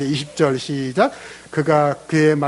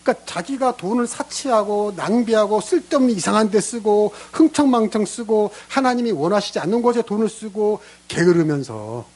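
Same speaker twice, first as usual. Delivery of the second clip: normal talking voice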